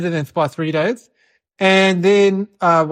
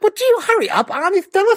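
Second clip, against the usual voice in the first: put-on impatient kid; 11 semitones higher than usual. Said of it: "I was like".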